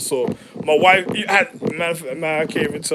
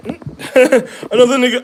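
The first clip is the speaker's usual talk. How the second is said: laughing; 7 semitones higher, 7 dB louder, much slower than usual. Real, not acted. Laughing through a retold story.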